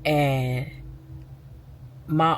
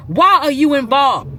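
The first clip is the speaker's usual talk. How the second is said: retold confrontation; 7 semitones higher, 6 dB louder than usual. Confronting the aunt.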